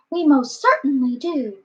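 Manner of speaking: high-pitched tiny voice; piping. A narrator's reading. Speck mayor confirming to Horton, piping high. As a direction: pipe it up, small and high. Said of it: "piped the voice".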